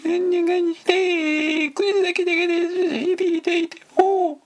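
very high tight voice breathing in; talking while inhaling. Shown as a demonstration. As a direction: squeak it out on the in-breath.

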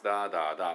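quick da da da beat hummed; da da da rhythm sung out on one syllable. Shown as a demonstration. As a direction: hum the rhythm pattern fast. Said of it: "っていうのね".

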